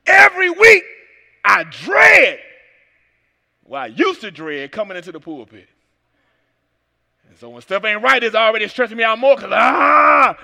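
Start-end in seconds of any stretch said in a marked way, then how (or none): 0.1-2.4 sudden loud preaching cry; loud outburst. Real, not acted. 9.5-10.4 short loud frustrated cry; frustrated yell. Real, not acted. Stressed outcry.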